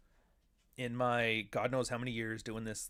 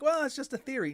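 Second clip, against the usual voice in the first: mocking skeptic; 13 semitones higher, 5 dB louder than usual. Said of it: "and you're like".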